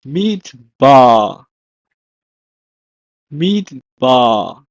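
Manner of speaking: midbar split, long falling last syllable; Hebrew pronunciation. Shown as a demonstration. Teacher gives the Hebrew for wilderness, careful midbar. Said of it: "is pronounced as".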